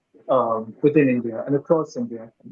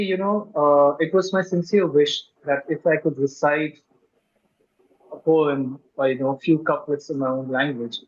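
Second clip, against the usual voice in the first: ordinary talk, nothing marked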